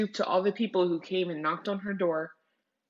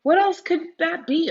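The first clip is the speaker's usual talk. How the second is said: wondering; 10 semitones higher, 8 dB louder than usual. Acted. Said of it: "Omu wondered".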